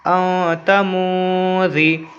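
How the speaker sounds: "औ तमूजी" slowly, both n sounds hummed in the nose; ikhfa nasal hold. Shown as a demonstration. Teacher reads the joined word, ikhfa nasal hold. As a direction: linger nasally on "औ" and "मू". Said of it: "आवाज़ को नाक में रोक कर पढ़ेंगे".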